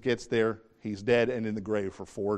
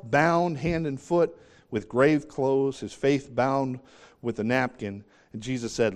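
ordinary delivery

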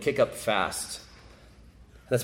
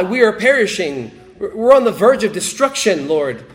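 panicked plea; 8 semitones higher, 9 dB louder than usual. Acted. Preacher voicing panicked disciples.

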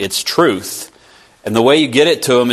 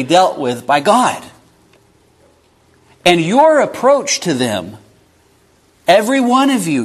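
fervent preaching; 7 semitones higher than usual. Acted. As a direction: preach it with rising passion. Preaching builds to full force.

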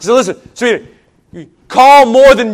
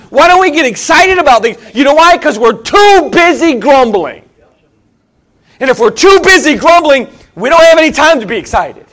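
raised, insistent pulpit voice, line after line; impassioned preaching; 4 semitones higher, 4 dB louder than usual. Real, not acted. Preacher rousing church for Bible conference, impassioned preaching. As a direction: drive each repeated plea home at full force.

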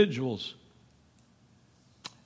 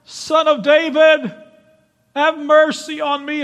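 hollering for mercy; 10 semitones higher, 7 dB louder than usual. Acted. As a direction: holler it across the crowd. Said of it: "he hollered".

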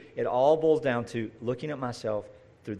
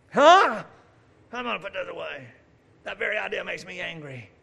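mock indignant objector; 4 semitones higher, 4 dB louder than usual. Mock objector refusing to let go.

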